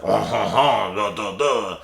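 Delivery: hammed-up mock French chuckle. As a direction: do a cartoon French accent. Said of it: "like, very stereotypical French".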